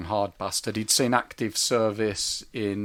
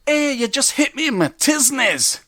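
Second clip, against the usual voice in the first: hammed-up Scottish soldier voice, high and slow; crap Scottish accent; 12 semitones higher, 6 dB louder, slower than usual. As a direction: imitate the Scottish ex-marine badly, pitch up. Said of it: "I apologise for the crap Scottish accent".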